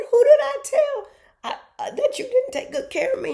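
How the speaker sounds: very high, loud comeback; mock incredulous mother. Mom denying she told anyone, loud and incredulous. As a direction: act out disbelief at the top of your voice. Retold quarrel with her son.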